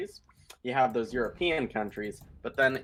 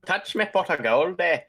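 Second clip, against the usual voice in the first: higher Irish leprechaun voice; 5 semitones higher, 4 dB louder than usual. Pretend. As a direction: brogue like a cheeky leprechaun. Mock leprechaun voice.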